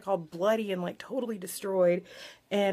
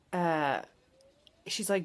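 ordinary talk

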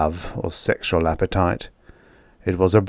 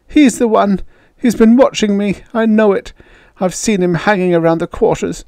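lightly voiced girl, higher, tearful; high young woman's voice, slightly louder; sobbing girl; 13 semitones higher, 5 dB louder than usual. A narrator's reading. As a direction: accuse him through tears. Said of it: "still sobbing".

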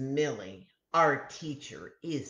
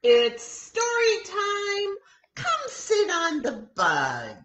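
high, loud sung teacher call; high singing teacher; sing-song teacher; 6 dB louder than usual. A narrator's reading. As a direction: sing out story time to the class. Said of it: "our teacher picks up a book".

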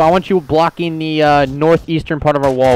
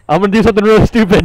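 fast, high imitating teammate; quick teasing impression of a friend; mock last words; 7 semitones higher, 4 dB louder, much faster than usual. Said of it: "His last words".

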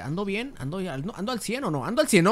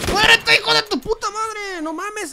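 high, panicked yelling at the top of the voice; grito de pánico; 12 dB louder than usual. Real, not acted. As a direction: scream in furious alarm at a sudden attack. Attacked suddenly in the game. (grito agudo y furioso de susto)

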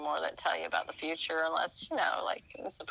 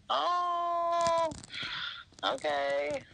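much higher, drawn-out meek acceptance; mock sheepish okay; 11 semitones higher, much slower than usual. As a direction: give in like a scolded kid. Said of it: "And I'm like".

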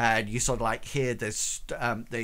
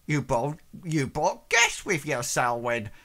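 higher, louder fussy servant voice; a flustered housemaid greeting guests; 4 semitones higher, 5 dB louder than usual. Acted.

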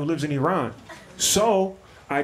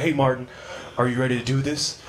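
low, subdued mock trainer greeting; low-key trainer; 5 semitones lower than usual. Sad trainer greeting his client at the gym, low-key. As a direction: ask it low and unexcited.

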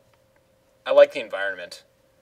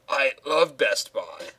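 ordinary talk, nothing marked

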